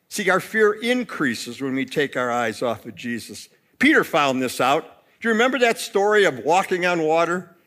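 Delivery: ordinary delivery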